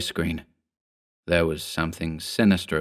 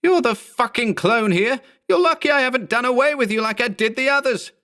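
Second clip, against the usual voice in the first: higher, quick angry rant; furious rant. A narrator's reading. Berman rants at his clone, high and fast. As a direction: spit it at him fast. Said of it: "he yelled".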